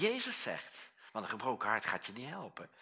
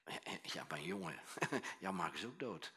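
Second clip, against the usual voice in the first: tender fatherly voice; 7 semitones lower, 8 dB quieter than usual. Acted. God the Father speaking to Jesus.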